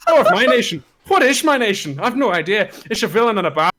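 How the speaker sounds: put-on retry the line in a hammier Irish accent; corrected Irish accent. Reader redoes McMorris's line in Irish accent. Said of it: "Sorry, wrong one".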